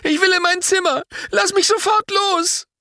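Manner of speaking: put-on high, shrill-pitched boy yelling to be let go; boy fighting his father's grip, screaming to be let go; child screaming. A narrator's reading. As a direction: scream it like a furious little boy fighting to get free. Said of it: "und schreit".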